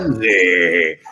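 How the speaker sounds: low, slow, drawn-out relish; mock-suggestive. Host milking the guest's sex-life joke, mock-suggestive. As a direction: savour it like a hammed-up innuendo.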